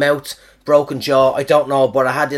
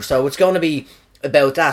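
normal talking voice

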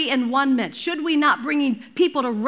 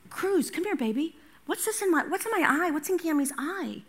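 coaxing grandma; 3 semitones higher, 6 dB quieter than usual. Retold or imitated.

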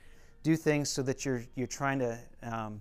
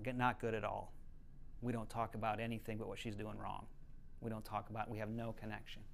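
quiet, choked-up retelling of tears; a hushed hint of crying, voice sinking; retold sobbing; 4 semitones lower, 10 dB quieter than usual. Mother confessing to Danny Silk, quietly tearful. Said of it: "started sobbing, crying".

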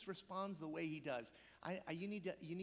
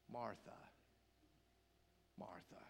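low, hushed names with long pauses; Jesus, tender sorrow; 10 semitones lower, 12 dB quieter than usual. Retold or imitated. Jesus weeps over weary listeners, calling low and tenderly. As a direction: call softly, aching for the listener. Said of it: "Jesus is saying".

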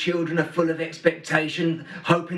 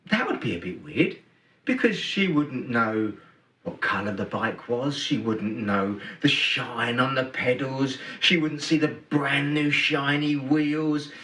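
normal talking voice

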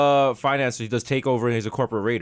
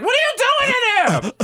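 high, louder suspicious call; hammed-up high wife voice; mock wife calling; 5 dB louder than usual. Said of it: "Heather's like".

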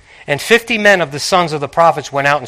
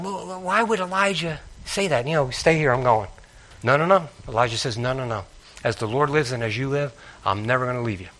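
quiet musing; 3 semitones lower, 11 dB quieter than usual. Retold or imitated. Musing aloud, retelling the exchange.

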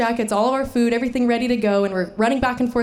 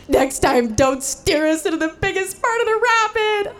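much higher, tearful crying voice; 9 semitones higher than usual. Retold or imitated. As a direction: plead through tears. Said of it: "Ah, I'm just crying".

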